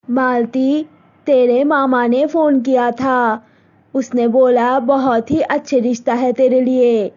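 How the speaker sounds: mother telling daughter about a proposal; Malti's mother. Acted. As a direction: speak as a set-in-her-ways village mother. Mother tells of marriage proposal.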